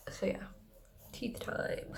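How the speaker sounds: soft whispered aside; whispering. Real, not acted. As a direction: breathe it out quietly. Quiet aside before brushing.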